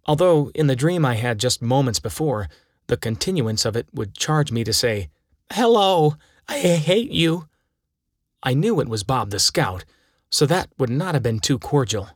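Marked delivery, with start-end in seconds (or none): 5.5-7.5 1 rude greeting. Acted. Dreamy greeting to phone caller.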